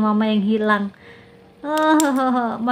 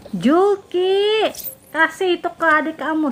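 raised, high calling scold; scolding mother; 5 semitones higher, slower than usual. Acted. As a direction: call out the child's name in reproach. Ibu memarahi anak yang merebut. (ibu mengomel)

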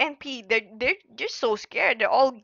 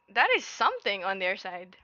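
usual speaking voice

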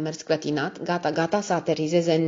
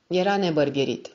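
plain reading voice

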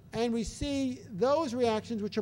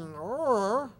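put-on annoyed baby grunt. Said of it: "as the baby is going".

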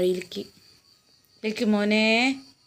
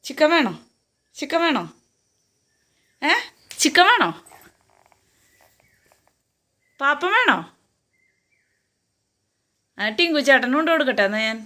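tempting with treats; 5 semitones higher than usual. Real, not acted. Tempting the dog with food.

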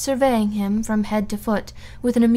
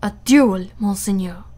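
choked with rage; 4 dB louder than usual. A narrator's reading. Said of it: "he stammered".

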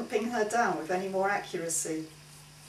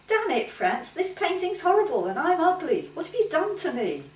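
higher and louder, outraged at the painting; woman furious at her ugly portrait, higher and louder; angry complaint; 10 semitones higher, 5 dB louder than usual. Acted. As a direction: lash out at the painter in a high, loud voice. Model sees ugly portrait.

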